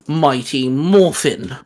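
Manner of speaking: ordinary talk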